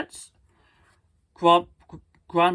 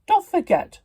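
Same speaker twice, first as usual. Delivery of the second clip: high, loud reminder; Donald calling out; 13 semitones higher, 5 dB louder than usual. Acted. Uncle reminding his nephews, high and loud. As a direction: sing out a warning.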